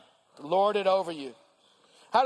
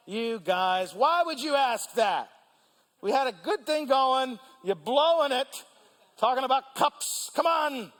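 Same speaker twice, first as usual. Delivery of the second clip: mock indignant disciples; 6 semitones higher, slower than usual. Disciples mad at James, John.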